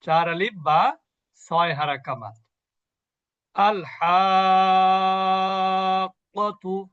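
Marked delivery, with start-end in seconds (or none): 3.5-6.9 আল হাক্কাতু recited very slowly, the alif held long; Quranic recitation. Shown as a demonstration. Teacher demonstrating a six-beat madd to students, slowly chanted. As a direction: draw out the long vowel in আল হাক্কাতু as in tajweed. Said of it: "এখানে মাদ্দে আসলি হচ্ছে আলিফ মাদ".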